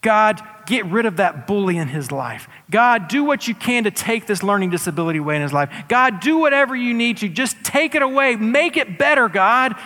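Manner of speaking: loud, high, begging prayer building in force; crying out to God, rising; desperate plea. Pretend. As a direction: plead harder and harder for it to stop. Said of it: "everything within me says".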